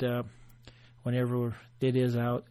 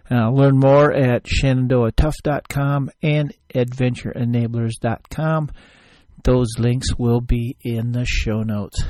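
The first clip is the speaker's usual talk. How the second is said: loud, slow sponsor call to action; ad read; 10 dB louder, slower than usual. Acted. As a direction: spell out the web address like an ad. End of the ad read.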